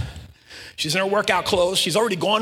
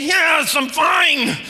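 higher-pitched strained protest; mock exasperated yell; 7 semitones higher, much slower than usual. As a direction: imitate a flustered kid insisting. Replaying the girl's exasperated answer.

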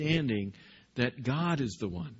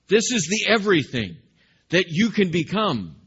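God answering firmly; 6 semitones higher, 7 dB louder than usual. Acted. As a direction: declare each word as the Creator. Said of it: "God comes back to him and say".

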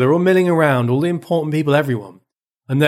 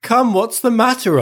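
concerned friend; 8 semitones higher than usual. A narrator's reading. Friend's line acted in reading.

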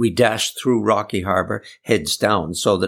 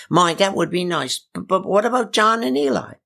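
put-on old lady voice; 10 semitones higher than usual. Aunt Polly voiced.

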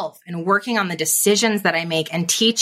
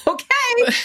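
higher pitched, still laughing through the words. Real, not acted. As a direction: pull yourself together mid laugh. Recovering from laughter.

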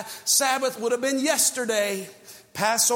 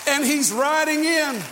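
preaching at full force; 4 semitones higher than usual. Real, not acted. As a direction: proclaim the Lamb to the crowd. Preacher building to climax.

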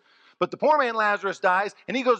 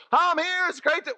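delighted arrival; 12 semitones higher than usual. Acted. Lazarus arriving in heaven.

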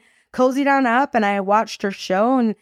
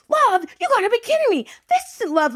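put-on much higher, squeaky exasperated teen voice; mock teen outburst; 13 semitones higher than usual. Teen mocks mother's love reason, exasperated high squeal. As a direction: play the furious eighteen-year-old, pitched way up. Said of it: "I went on and on about love".

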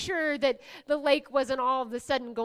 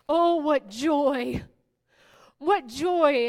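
joyful exclaim; 6 dB louder, much slower than usual. Acted. Preacher exclaims joy.

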